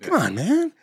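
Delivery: high-pitched mock exasperated appeal; Joe Biden. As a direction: exaggerate the plea as a quick political impression. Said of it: "I sound like Joe Biden".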